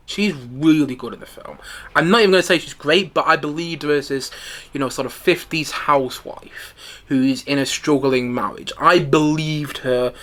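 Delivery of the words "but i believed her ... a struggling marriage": ordinary talk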